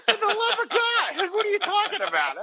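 real amused outrage breaking into laughter; laughing disbelief. Real, not acted.

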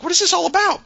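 high, loud outraged question; mock indignation; quoting the comic's teacher, outraged; indignant teacher. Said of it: "She's like".

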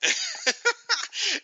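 high laughing fit; high burst of laughter. Real, not acted. Reacting to listener letter.

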